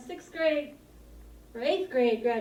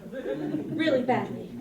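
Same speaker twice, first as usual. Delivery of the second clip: ordinary talk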